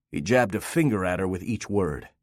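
ordinary delivery

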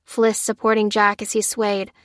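ordinary delivery, nothing marked

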